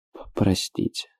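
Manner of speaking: choked, stammered half-whisper; hushed, breaking apology. A narrator's reading. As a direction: falter into a soft, tearful apology. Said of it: "полушёпотом сказал он".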